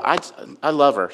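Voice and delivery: usual speaking voice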